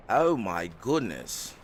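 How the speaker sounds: normal talking voice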